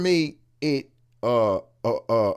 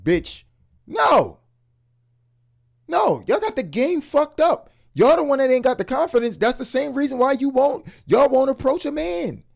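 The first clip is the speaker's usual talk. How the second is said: high, loud and fired up; heated rant; 11 semitones higher, 4 dB louder than usual. Real, not acted. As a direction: call them out hard, voice way up.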